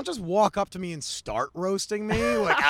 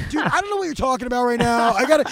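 confused outburst; 7 semitones higher, 8 dB louder, faster than usual. Retold or imitated. Tripping on acid, confused.

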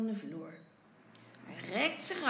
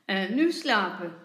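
loud, firm father's bedtime command; stern order; 9 dB louder than usual. A narrator's reading. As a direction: order the child to sleep. Angry father orders sleep.

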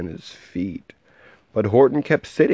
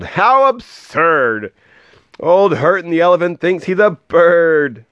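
high, teasing jeer; mock him loudly; taunting yell; 9 semitones higher, 4 dB louder than usual. Friends taunting Horton, high mocking yell. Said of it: "they yelled".

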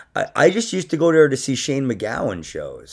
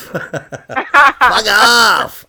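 laughing; 10 semitones higher, 8 dB louder than usual. Real, not acted. Laughing repeat of the punchline.

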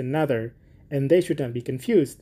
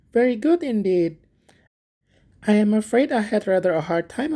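higher, eager simple gardener voice; little Hans; 5 semitones higher than usual. Acted. Hans thanking the miller for asking, high and eager. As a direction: play Hans as a humble, grateful friend.

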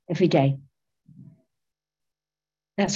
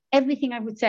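ordinary talk

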